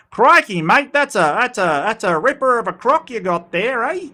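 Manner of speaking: high, loud, exaggerated Aussie twang; crikey it up like a hammed-up Australian; broad Australian accent. Shown as a demonstration. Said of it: "that's an Australian accent".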